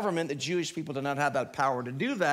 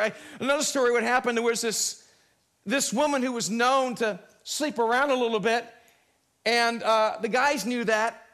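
higher, impassioned pulpit delivery; preaching; 6 semitones higher, 4 dB louder than usual. Real, not acted. Preacher telling the congregation that God created it, preaching. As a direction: preach it out to the whole room.

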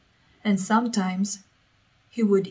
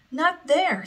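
sharp high yell of protest; 8 semitones higher than usual. Acted. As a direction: snap it out, stopping her short. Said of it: "Sophia yelled".

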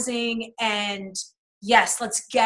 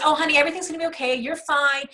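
quick, higher, sing-song mock comfort; sugary reassurance; 6 semitones higher, 4 dB louder than usual. Imitating her old self soothing her angry husband, mock-sweet reassurance. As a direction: brush his anger off with sweet cheer.

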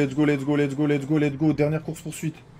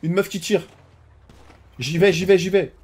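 excited shouting; 8 semitones higher, 10 dB louder than usual. Real, not acted. Spotting shooter during chase.

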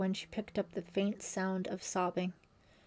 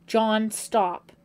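loud, sharp command to stop; urgent cry; 2 semitones higher, 9 dB louder than usual. A narrator's reading. Girl stopping John as he raises the knife, urgent cry. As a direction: cry out to halt him. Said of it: "cried a girl's voice".

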